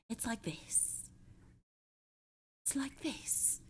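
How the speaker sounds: whispered, breathy and strained voice; breathy whisper try. Shown as a demonstration. Streamer trying out the Voldemort voice, breathy strained whisper. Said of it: "Breathy and strained".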